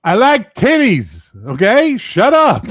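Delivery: very high, loud, slow squeal; mock squeaky outburst. Teased about his nickname, squealing back. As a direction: squeal it defensively as a joke.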